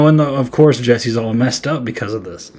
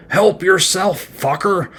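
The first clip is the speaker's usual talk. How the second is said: slow, sneering killer's taunt, pitched up; menacing Freddy; 7 semitones higher, slower than usual. Retold or imitated. Freddy taunting the partygoer before stabbing him, slow sneer. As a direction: taunt him slowly as the villain.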